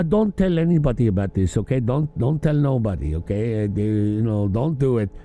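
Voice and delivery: lightly voiced girlfriend pleading for secrecy; mock secretive woman. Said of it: "the bitch told him".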